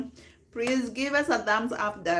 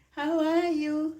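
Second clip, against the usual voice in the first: high, slow sing-song; sing-song greeting; 7 semitones higher, 4 dB louder, slower than usual. Real, not acted. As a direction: draw out the how are you. Repeated drawn-out greeting.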